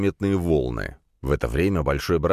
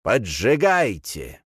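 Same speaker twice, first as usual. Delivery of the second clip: slow, drawn-out order, pitched higher; cult leader's command; 4 semitones higher, much slower than usual. A narrator's reading. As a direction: give the order with relish. Cult leader orders fire lit. (протяжный приказ главаря секты)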